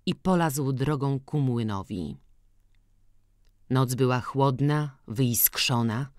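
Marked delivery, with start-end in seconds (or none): none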